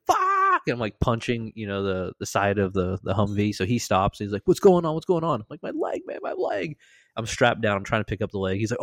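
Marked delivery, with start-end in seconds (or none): 0.1-0.6 very high mock scream of pain; retold scream. Patient as broken leg falls, imitated high scream. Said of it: "I'm screaming". 4.4-5.4 high-pitched worried questioning; retold alarm. Driver stopping for the screaming patient, mock alarm. 5.6-6.8 very high, softer squeal; mock pained wail. Injured patient to the driver, high mock wail. Retold cry of pain.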